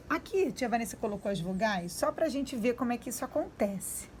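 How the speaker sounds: ordinary delivery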